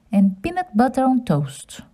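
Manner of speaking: ordinary delivery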